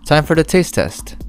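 usual speaking voice

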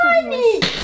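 whiny protest. Real, not acted. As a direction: whine it out in protest. Child protests about English. (criança reclamando alto e mais aguda)

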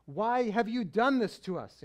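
a worried mother scolding her runaway son; scolding mom. Retold or imitated. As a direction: lay into him like an exasperated mom. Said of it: "starts in on him like a regular mom".

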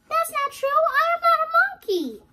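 high, loud indignant denial. Acted. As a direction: protest loudly, high-pitched. Reader denies being monkey.

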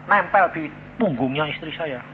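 ordinary delivery

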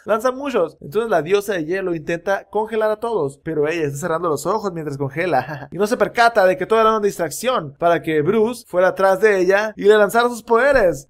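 normal talking voice

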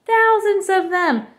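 high, slow, stretched-out wail; frightened child's exaggerated cry; storybook wail. A narrator's reading. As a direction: draw it out in acted dread. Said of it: "she wailed".

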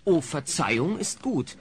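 ordinary talk, nothing marked

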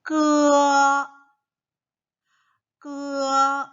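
slow, loud, high 끄어; drawn-out model syllable. Shown as a demonstration. Teacher modelling 끄어 slowly for children. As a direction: stretch 끄어 for the class to copy. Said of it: "같이 한번 볼 겁니다".

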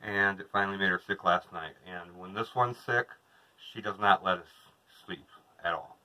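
ordinary talk

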